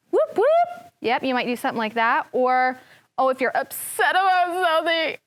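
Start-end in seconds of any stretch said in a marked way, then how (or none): 0.1-0.9 high whooping cheer; excited cheer. Shown as a demonstration. Teacher cheering to show excitement. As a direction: cheer like the weekend is here. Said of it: "excited for the weekend".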